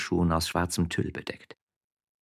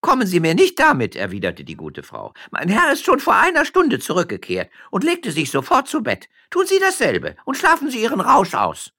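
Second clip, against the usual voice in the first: put-on high, loud scolding old nurse; 9 dB louder than usual. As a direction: tell the drunk off from the window. (Amme schimpft laut den vermeintlichen Trunkenbold aus)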